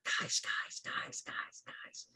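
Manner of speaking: fading whisper calling guys; whispering plant voice. Acted. Game master voicing a plant, fading whisper. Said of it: "kind of make out a little bit of".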